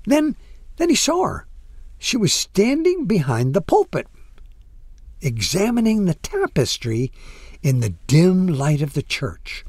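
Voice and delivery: ordinary delivery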